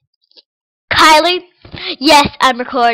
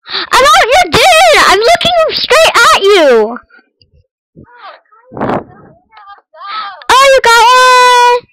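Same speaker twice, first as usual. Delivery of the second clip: indignant yelling; 8 semitones higher, 5 dB louder, slower than usual. Real, not acted. Yelling at game player.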